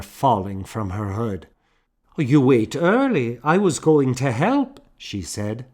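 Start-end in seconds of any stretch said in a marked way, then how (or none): 2.2-4.8 higher, louder woman; a hint of a cheerful plump woman; lightly voiced plump woman; voiced Fiona. A narrator's reading.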